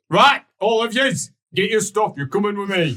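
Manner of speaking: gruff order to move out; barked dwarf orders. Acted. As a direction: round up the troops at full voice. Dwarf rounds up the party.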